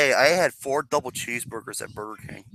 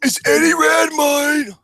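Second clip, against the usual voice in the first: high, slow, drawn-out shout; hammed-up, high strained proclamation; Eddie Redmayne impression; 12 semitones higher, 6 dB louder, slower than usual. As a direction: wail the name theatrically.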